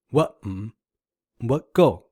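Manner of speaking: WHAT rising, MM dipping, GO falling; tone pair drill. Shown as a demonstration. Teacher finding all combinations, contrasting pitch shapes. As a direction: lift each WHAT, then dip MM or drop GO. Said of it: "find all the combinations".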